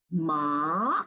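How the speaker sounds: 'Ma' starting low and sliding up; rising tone. Shown as a demonstration. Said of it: "rising from the lower part".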